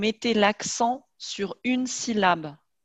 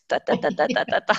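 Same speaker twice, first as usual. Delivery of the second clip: machine-gun rattle; faster than usual. Retold or imitated. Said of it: "comme une mitraillette".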